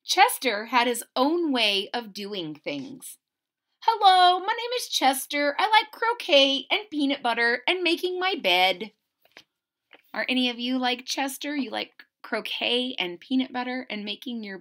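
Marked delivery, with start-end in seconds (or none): 3.9-8.9 put-on higher, louder little mouse boy voice; bright eager kid introducing himself; lightly voiced proud little boy; Chester's voice.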